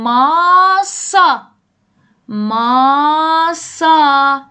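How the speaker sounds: slow, loud demo of ماسا; slow teaching voice. Shown as a demonstration. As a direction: model ماسا slowly for the class. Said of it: "اینو ببین".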